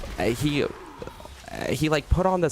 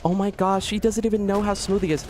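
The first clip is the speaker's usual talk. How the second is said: much higher, swooning fangirl; mocking women in the comments, swooning high; mock swooning fan; 10 semitones higher than usual. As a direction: gush like a smitten commenter. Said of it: "they're all like".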